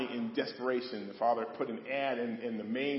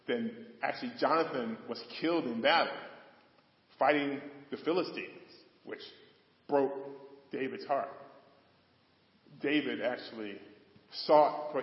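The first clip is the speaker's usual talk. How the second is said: usual speaking voice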